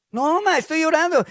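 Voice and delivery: high, loud pleading protest; protesting son. Acted. Teenage son accused of drinking, loud high protest. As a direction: plead innocence to your mother.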